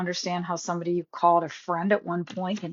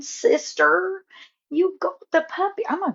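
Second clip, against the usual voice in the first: excited squeal; 11 semitones higher than usual. Real, not acted. Excited over sister's new puppy.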